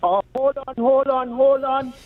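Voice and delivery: normal talking voice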